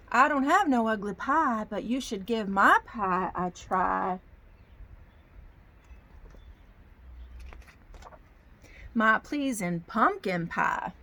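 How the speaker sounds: slow Southern drawl for a storybook grandpa; lightly voiced Southern grandpa bear; Southern accent. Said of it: "I get a Southern accent".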